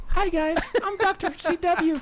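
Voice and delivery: very high, squeaky doctor voice; Chris impression. Host mocking his co-host's introduction, high squeaky Chris voice. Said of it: "here's my Chris".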